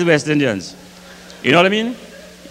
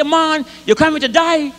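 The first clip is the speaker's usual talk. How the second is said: Bajan taxi driver; 8 semitones higher than usual. Retold or imitated. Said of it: "He say".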